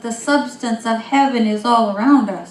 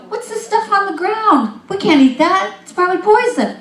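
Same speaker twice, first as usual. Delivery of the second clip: high, loud mock alarm; freaking out; 11 semitones higher, 7 dB louder than usual. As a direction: playfully panic over the strange food. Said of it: "They were freaking out".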